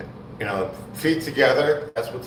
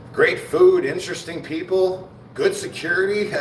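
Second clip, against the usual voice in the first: sarcastic; 7 semitones higher than usual. Acted. Sarcastic praise of prison.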